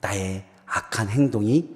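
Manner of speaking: low, soft, measured preaching; quiet, grave appeal to the congregation; solemn reflection. Real, not acted. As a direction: bring it down to a hushed, searching tone. Preacher turns story into solemn reflection.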